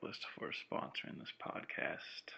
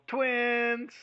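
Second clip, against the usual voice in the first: very high, drawn-out "twins"; silly call-out; 13 semitones higher, 4 dB louder than usual. Acted. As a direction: sing out "twins" in a high squeal. Silly call-and-response word on phone.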